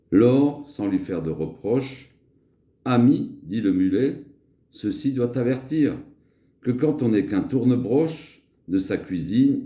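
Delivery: measured verse recital with pauses; lecture de fable. Acted. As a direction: read a fable aloud, lifting the characters' lines. Lit une fable de Florian. (récitation de fable, posée et expressive)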